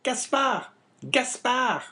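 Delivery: high, loud woman's character voice; wife calling out. A narrator's reading. Wife calling from the stairs, high and insistent. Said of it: "murmured the woman from her seat".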